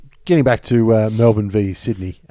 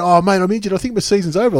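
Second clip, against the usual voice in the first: higher, louder, hammed-up moaning; mock whiny injured player; 8 semitones higher, 4 dB louder, faster than usual. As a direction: play the sorry-for-yourself injured bloke. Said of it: "You go".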